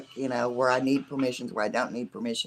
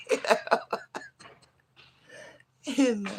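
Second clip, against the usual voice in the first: laughing; 10 semitones higher than usual. Real, not acted. Laughing at mom's reaction.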